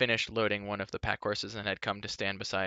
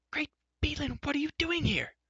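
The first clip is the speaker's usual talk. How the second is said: much higher, startled exclamation; a surprised bard. A narrator's reading. Bard spotting Elodie, startled and high. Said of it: "He caught sight of Elodie".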